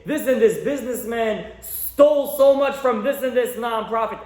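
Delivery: mock scandal headline.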